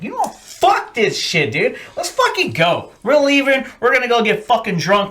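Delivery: high, rising fed-up outburst; mock fed-up rant. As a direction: storm off in a huff. Angrily ditching the girls.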